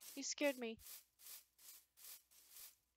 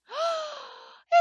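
sharp delighted gasp; excited gasp; 12 semitones higher, 5 dB louder than usual. Real, not acted. Sees another Tinky Winky.

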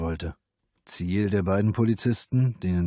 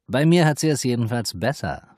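defensive; 6 semitones higher, 6 dB louder than usual. A narrator's reading. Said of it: "verteidigte sich die Hure".

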